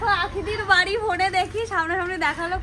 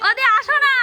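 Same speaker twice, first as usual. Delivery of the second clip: calling out; 8 semitones higher, 7 dB louder than usual. Real, not acted. Calling the son over.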